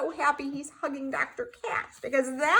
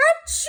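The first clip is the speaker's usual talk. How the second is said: huge high sneeze, bursting out; big sneeze; 14 semitones higher, 4 dB louder than usual. Acted. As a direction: let a giant sneeze explode. Gerald sneezes in story.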